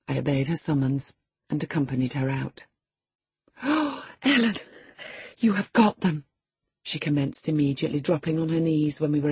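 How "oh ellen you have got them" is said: put-on higher girlish dismay. A narrator's reading. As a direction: caught out and desperate. Girl dismayed at discovery.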